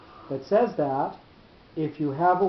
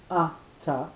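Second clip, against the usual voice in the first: ata in two separated beats, A-ta, first one stressed; stress on first syllable. Shown as a demonstration. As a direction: put the accent on the A of ata. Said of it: "with the accent on the first syllable".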